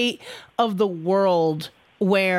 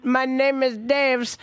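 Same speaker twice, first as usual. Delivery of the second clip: imitated Indian call-center accent, slowed right down; mock thick accent; 2 semitones higher, slower than usual. Caller mimics yet another outsourced phone agent, mock Indian accent. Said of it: "such a thick accent".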